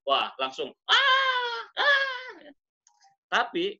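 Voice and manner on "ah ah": very high, loud "aah"; high-pitched mock scream; imitated cry of pain. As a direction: yelp as if suddenly jabbed. Acts out patient's cry of pain.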